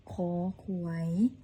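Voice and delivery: usual speaking voice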